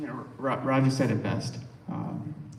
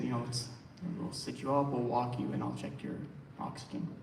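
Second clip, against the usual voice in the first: soft reassuring; 10 dB quieter than usual. Retold or imitated. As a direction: coax her kindly, like a nurse. Nurse soothing patient.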